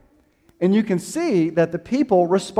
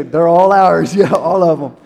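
laughing reply; 8 dB louder than usual. Retold or imitated. Proud parents answering, laughing.

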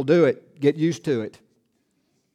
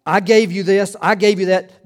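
"gave" punched louder; emphatic reading; 2 semitones higher, 5 dB louder than usual. Shown as a demonstration. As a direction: hit "I gave" hard each time. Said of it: "I tried to read it with some emphasis".

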